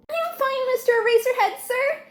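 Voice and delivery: a shy fan answering his idol; awestruck boy. Acted.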